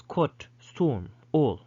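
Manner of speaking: closer, rounded British O; British "storm" and "all" with rounded vowel; British pronunciation. Shown as a demonstration. Teacher showing the British version, British vowel demonstrated. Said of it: "But in British English".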